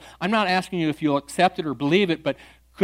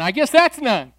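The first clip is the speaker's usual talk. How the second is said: higher, louder playful jab; teasing; 7 semitones higher, 6 dB louder than usual. Retold or imitated. Pastor teasing the atheist about his answer, playful. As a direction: rib him with a grin.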